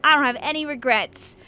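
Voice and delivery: ordinary talk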